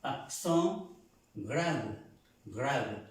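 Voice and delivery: "Accent grave" in French, word by word; French "grave", falling, final E nearly silent; barely sounded final E. Shown as a demonstration. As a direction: let the last E of "grave" almost vanish. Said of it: "casi que no se pronuncian".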